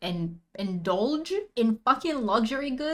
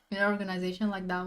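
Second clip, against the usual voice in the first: usual speaking voice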